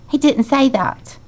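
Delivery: plain reading voice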